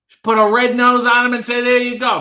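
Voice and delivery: annoyed zebra mocking. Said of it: "annoyed anthropomorphic zebra".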